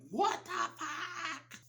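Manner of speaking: hammed-up outraged shock; shocked outcry. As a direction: cry it out in mock disbelief. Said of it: "people were like".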